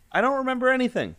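sudden high, loud and fast; high excited burst. Acted. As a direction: blurt it out brightly. Robot character declares amnesia.

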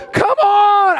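high, excited shout; big high-pitched cheer; exhorting shout. Real, not acted. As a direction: shout it high to rouse the crowd. Urging congregation to celebrate miracle.

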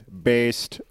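flat, mechanical butler voice; robot voice. Pretend. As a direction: speak like a programmed robot. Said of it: "answers in his robot voice".

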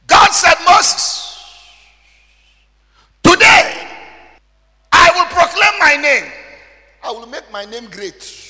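loud, high, drawn-out preaching shout; full-force preaching. Acted. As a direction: thunder the scripture at the congregation. Preaching the Moses passage.

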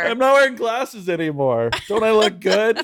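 high, showy put-on voice; fishing for compliments; mock proud self. Showing off her new contacts to friends, high and showy. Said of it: "everyone's like".

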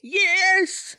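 put-on very high, loud old-lady yes; British old lady. Co-host confirms the old lady character, high granny voice. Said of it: "British old lady?".

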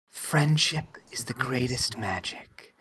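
low, slow whispered message; hushed, drawn-out mysterious whisper; secret whisper. Acted. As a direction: whisper it slowly, as a secret voice in the ear. GM voices secret message to player.

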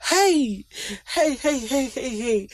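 playful high hollers that fade out; excited hey. Real, not acted. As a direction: call out then ease off. Exclaiming, fading.

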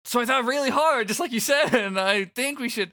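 put-on high, excited teen boy; Fret voice. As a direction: gush it out, high and eager.

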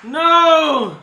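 loud high laughing outcry; shocked shout. Real, not acted. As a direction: yell no in mock horror.

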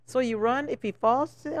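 normal talking voice

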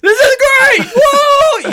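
very high, loud laughing whoop; laughing thrill. Pretend. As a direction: squeal with laughing delight mid-fall. Imitating tandem jumper's thrill.

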